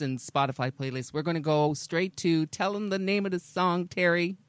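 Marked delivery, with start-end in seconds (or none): none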